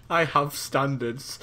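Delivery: slow, mocking imitation of his friend's American voice; mock Colin accent. Mocking his friend's complaint about the cold, slow and put on. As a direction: parrot Colin's line back in a put-on accent. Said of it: "That's my Colin accent".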